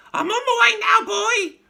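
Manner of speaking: put-on high, loud screechy old woman calling; old grandma calling from above; calling crone. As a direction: call down triumphantly. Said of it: "she called down to George".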